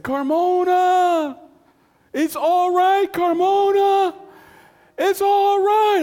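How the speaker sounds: very high, long-drawn holler; loud stretched yell of good news; shouted reassurance. Retold or imitated. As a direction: call it out across a distance. Soldier calling out from afar.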